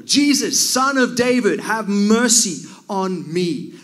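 high, loud, drawn-out plea. Retold or imitated. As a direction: call out desperately for mercy, slowing at the end. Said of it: "he cried out all the more".

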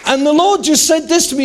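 ordinary talk, nothing marked

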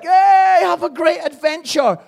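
high, cheery mock farewell; happy send-off. Dad rehearsing a cheerful airport goodbye for his daughter, happy and high. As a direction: put on a big upbeat goodbye cheer, pitched high. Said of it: "We've got to be happy".

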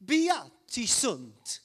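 slow, drawn-out Italian accent. Shown as a demonstration. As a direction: stretch it out in a loud Italian accent. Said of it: "say this with a, an Italian accent".

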